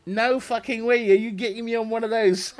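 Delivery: high and loud, laughing through it; laughing to himself at the thought of riding; laughing refusal. Retold or imitated. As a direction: laugh it off, no way you're getting on. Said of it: "I'm thinking to myself".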